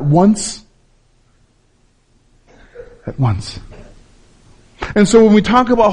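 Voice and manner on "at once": hushed emphasis. Real, not acted. Sermon point dropped softly.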